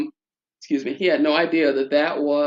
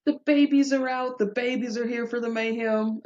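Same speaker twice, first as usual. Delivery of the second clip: put-on delighted baby talk; 9 semitones higher than usual. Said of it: "I was like".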